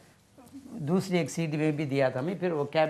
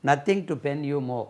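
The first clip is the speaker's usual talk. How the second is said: Nothing to pen you more said slowly with weight on Nothing; slow, nothing stressed; much slower than usual. Shown as a demonstration. Teacher reading the example phrase slowly. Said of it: "Pen is used here".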